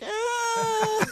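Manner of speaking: high-pitched mock crying wail; imitating a long, frightened cry; panicked wail. Would-be Marine phoning recruiters at four, panicked wail.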